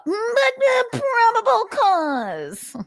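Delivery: very high, slow whiny probable cause; Nick impression. Narrator mocking Nick repeating his line, squeaky and slow. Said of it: "Nick's impression".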